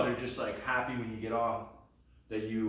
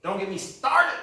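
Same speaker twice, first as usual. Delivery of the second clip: high, loud burst of indignation; ranting outburst; 10 semitones higher, 11 dB louder than usual. Acted. Comic breaking into a rant on statehood, shouted. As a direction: erupt suddenly, pitched way up.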